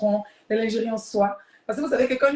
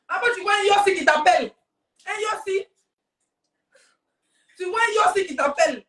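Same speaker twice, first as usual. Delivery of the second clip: high, fired-up storyteller aside; pitched up and punched, with bursts of volume; excited; 7 semitones higher, 3 dB louder than usual. Acted. Telling the girls about the incoming call, excited.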